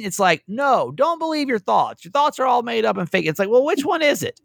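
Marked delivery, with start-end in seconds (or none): 0.5-3.2 high-pitched, emphatic contradiction; mock insistent advice. Radio host mimicking advice-givers on self-talk, mock insistent. 3.5-4.4 high-pitched, baffled protest; mock exasperation. Radio host answering contradictory advice, mock exasperated.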